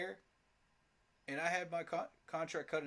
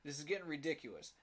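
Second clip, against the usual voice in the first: ordinary talk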